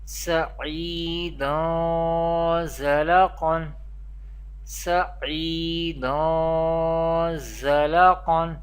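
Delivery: "Sa'idan" with the final n held as a nasal hum into "Zala"; Ikhfa with ghunna. Shown as a demonstration. Teacher demonstrating Ikhfa in Arabic accent, nasalized drawn-out recitation. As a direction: recite "Sa'idan Zala Qan" slowly, sound taken into the nose on "dan". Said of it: "we perform Ikhfa with Ghunna".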